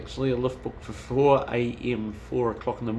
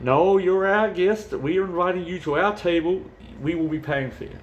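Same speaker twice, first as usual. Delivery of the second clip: Pat insisting; 6 semitones higher than usual. Retold or imitated. Retelling Pat insisting he pays.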